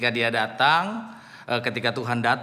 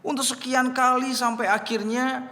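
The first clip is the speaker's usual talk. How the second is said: usual speaking voice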